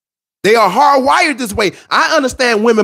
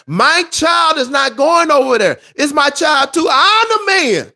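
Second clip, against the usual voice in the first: firm shouted refusal; 6 semitones higher, slower than usual. Retold or imitated. Acting the firm man refusing.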